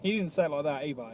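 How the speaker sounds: ordinary talk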